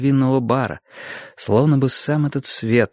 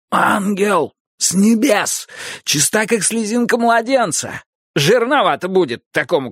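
high, exclaiming hammed-up praise; mock rapture; 10 semitones higher than usual. Boris mockingly extols the bride, teasing Potter. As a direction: gush like a pilgrim before an icon.

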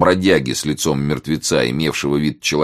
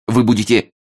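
higher, louder indignant threat; snapping back; 6 semitones higher, 5 dB louder than usual. A narrator's reading. Thrashed young reporter threatens Martin, snapping back. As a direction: snap it back, stung. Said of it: "огрызнулся тот".

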